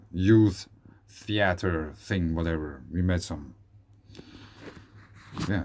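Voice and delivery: normal talking voice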